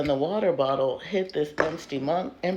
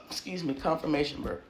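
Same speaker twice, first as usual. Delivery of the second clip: normal talking voice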